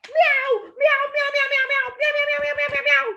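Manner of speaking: high, rapid string of mews; cat meowing. A narrator's reading. Kitty trapped in snow, high repeated meowing. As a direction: meow frantically like a kitten. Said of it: "Does that sound like a cat?".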